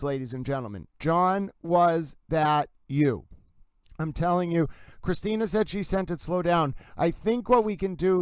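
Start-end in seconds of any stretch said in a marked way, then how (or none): 1.0-3.2 "was that you" at half speed; four separate words. Shown as a demonstration. Host insisting to listeners it's four words, slow and separate. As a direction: set each of the four words apart. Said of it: "It's four words".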